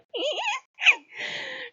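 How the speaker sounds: squealing laugh, falling off; high laugh. Real, not acted. Laughing at own story.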